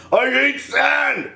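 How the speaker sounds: slow, drawn-out brooding line; mock Anakin. Vlogger parodies Anakin's moody sand line. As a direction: sulk it out slowly.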